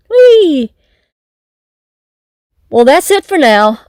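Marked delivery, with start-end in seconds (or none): 0.1-0.7 high, gleeful "whee". Acted. Announcer celebrating two birthdays on one day, gleeful whee.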